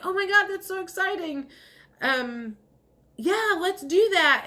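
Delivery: excited friend. Retold or imitated. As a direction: gush like a thrilled friend. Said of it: "they were like".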